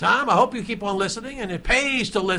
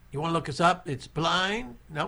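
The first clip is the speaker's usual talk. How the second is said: normal talking voice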